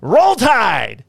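high, loud football cheer; shouted cheer. Retold or imitated. Cop taunting drunk Alabama fan, high shouted cheer. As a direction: yell it from the back like a fan. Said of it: "in the background going".

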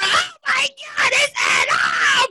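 high, wailing crowd hysteria; hammed-up squeal; tearful fan. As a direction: mimic a fan in happy tears, very high. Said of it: "people was over there shed tears".

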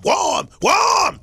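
high, loud repeated "warm"; dramatic shout. Acted. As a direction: bellow it like a grand finale. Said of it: "Was that dramatic or what".

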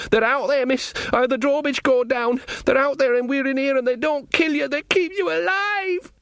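high panicked soldier, climbing to a strained squeal; terrified Sean Ogg. Acted. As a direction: babble in terror, pitch rising right to the end. Narrator voices frightened Sean in a story.

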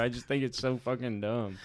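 ordinary delivery

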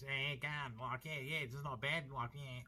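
over-the-top Aussie caricature voice; ramped Aussie accent. Shown as a demonstration. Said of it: "what I mean by a ramped Aussie accent is".

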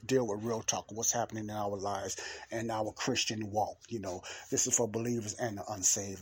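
ordinary delivery

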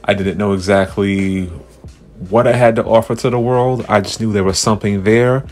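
big booming show host voice; announcer. Pretend. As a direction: play the grand host welcoming fans. Grand mock podcast host intro.

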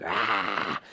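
low, drawn-out raspy "aah"; hammed-up villain growl; raspy mock growl. Shown as a demonstration. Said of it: "it's like".

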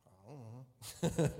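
put-on small child voice. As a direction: answer like a guilty four-year-old. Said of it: "she goes".

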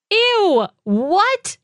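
real startled disgust; grossed out. Real, not acted. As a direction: recoil at something gross. Reacting to daughter's gross phrase.

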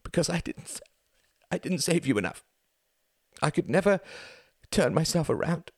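high, cracked, sobbing voice; voice breaking. A narrator's reading. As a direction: let the voice break completely. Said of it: "voice broke completely".

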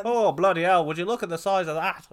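loud, high Irish exclamation, amazed; Irish voice, hamming up the amazement; Irish accent. Retold or imitated. Joking with a friend in a loud Irish accent. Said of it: "I don't know why he's Irish".

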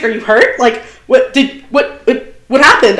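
imitating a frantic boyfriend; worried boyfriend. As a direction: retell his panicked questions, a little over the top. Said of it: "he's like".

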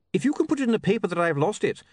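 high, quick, hopeful plea; mildly hopeful little man; unwarranted hopefulness. Acted. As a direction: suggest it eagerly in a high voice. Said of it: "with unwarranted hopefulness".

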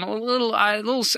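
ordinary delivery

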